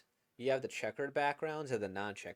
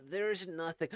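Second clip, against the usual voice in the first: ordinary delivery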